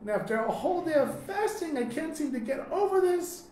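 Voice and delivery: a shrieking rage over the spilled milk; furious screaming. Retold or imitated. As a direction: scream it like a man losing his temper. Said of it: "goes crazy and starts screaming".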